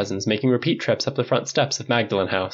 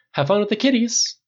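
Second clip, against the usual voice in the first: Tony pitched much higher, light tease; teasing Tony; 10 semitones higher than usual. A narrator's reading. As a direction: toss it off high and breezy.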